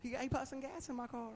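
high, soft, gushing imitation; imitating a grateful daughter. Preacher mimics her thanks for the gas, high gushing voice. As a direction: pitch it up in sweet, surprised gratitude. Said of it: "she saw it right off. Said".

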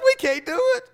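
mock wail of despair; mock wailing soldier. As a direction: let the whine climb into a comic wail. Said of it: "starts weeping and crying".

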